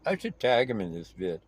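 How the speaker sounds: ordinary talk, nothing marked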